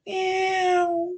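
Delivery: high, whispery cat-woman mews; slow, sly, high mewing. Acted. Cat-woman teasing the officer from the bedroom, high mewing.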